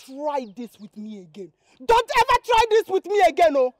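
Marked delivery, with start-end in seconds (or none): 1.8-3.8 loud threatening warning; girl loudly threatens the pushy suitor; angry warning. Acted. Girl's fierce warning.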